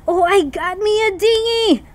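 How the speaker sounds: high gasp then thrilled cry; delighted at the new boat; excited gasp. Real, not acted. Player thrilled at getting a dinghy.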